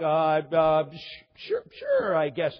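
mock bewildered kid, tripping on the first sound; halting, reluctant acted reply; hesitant kid. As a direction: stammer through an unsure agreement. Retold kid unsure how to answer.

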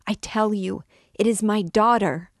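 loud, high, a mother refusing to let go; piercing cry. A narrator's reading. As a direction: hurl it at them, frantic. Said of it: "her voice now pierced the ear like a saw".

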